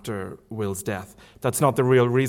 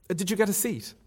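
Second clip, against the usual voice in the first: put-on young woman's voice, higher, lightly protesting; reader's higher Marion voice; 8 semitones higher than usual. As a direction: lift the pitch for an eager young lady.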